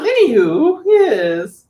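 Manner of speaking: high, loud hammed-up British character voice; grand, boisterous British put-on voice; Queenie, British character. Performer shows how her stage character talks, over-the-top British. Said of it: "start talking as that particular character".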